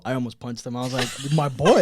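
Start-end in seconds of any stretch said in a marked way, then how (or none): 1.3-1.8 put-on loud, indignant "My boy?"; outraged retort. Father outraged at son's greeting, loud indignant echo. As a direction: bark it back, offended.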